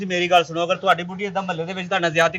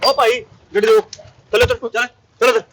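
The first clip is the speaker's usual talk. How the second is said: loud, higher shouts at the wife to get out; shouting orders; 5 semitones higher, 4 dB louder than usual. Acted. Husband shouts at his wife to get out of the taxi, loud and high. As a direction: bark at her to get out of the car.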